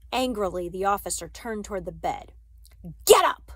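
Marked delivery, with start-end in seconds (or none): 3.1-3.5 high, loud sudden command; angry order. A narrator's reading. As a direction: shout it as a harsh order. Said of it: "he ordered".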